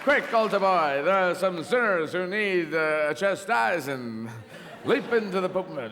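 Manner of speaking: hammed-up heroic command to a sidekick; rally your altar boy like a comic-book crimefighter; mock superhero. Pope as superhero orders his sidekick.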